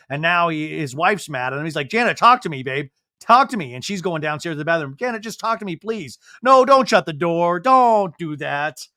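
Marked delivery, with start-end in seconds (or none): 1.9-3.7 louder, higher imitation of pleading; urgent pleading. Husband following his upset wife, urgent pleading. 5.0-8.9 higher retold plea, building louder; urgent pleading. Husband begging his wife at the door, urgent pleading.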